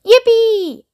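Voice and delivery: very high whooping yippee; joyful shout. Acted. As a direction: whoop like an old man on a ride. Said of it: "shouted Grandpa Joe again".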